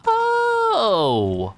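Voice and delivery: high, sudden startled oh; real surprise at a find in the game; surprised oh. Real, not acted. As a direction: yelp it out in surprise. Finds bullets in the game.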